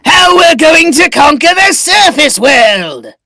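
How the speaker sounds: loud, high villain boom; booming frost giant. Acted. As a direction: proclaim it like a giant warlord. Villain plans to conquer surface world.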